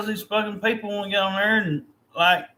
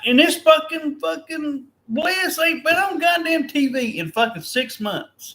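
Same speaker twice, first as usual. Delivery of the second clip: real heated, high-pitched outburst; exasperated rant; 7 semitones higher, 3 dB louder than usual. Real, not acted. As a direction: vent in raised, exasperated disbelief. Exasperated rant about wrestler's absence.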